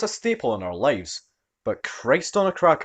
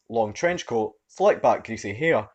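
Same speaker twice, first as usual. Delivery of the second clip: ordinary delivery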